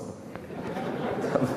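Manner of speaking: a quiet airy chuckle; soft breathy laugh. Real, not acted. Soft laugh recalling the stranger's "laugh again". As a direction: laugh softly at the memory.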